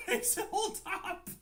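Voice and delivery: high laughing collapse. Real, not acted. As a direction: dissolve into giggles. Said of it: "before I lose my mind".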